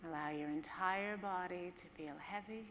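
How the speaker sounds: calm, hushed guide easing listeners down; guided meditation. Acted.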